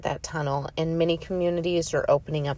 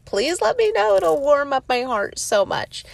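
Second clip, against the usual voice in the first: eager plea; 9 semitones higher than usual. Real, not acted.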